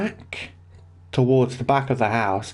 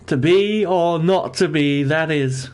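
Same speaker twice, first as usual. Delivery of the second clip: higher, louder mock-theatrical line; dramatic Shakespeare; 5 semitones higher, 4 dB louder than usual. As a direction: ham up the famous quote, lightly. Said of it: "as the old age question is".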